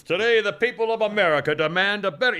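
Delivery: loud, high mock political speech; pompous orator. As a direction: boom it like a grand podium speech. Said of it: "give a speech of".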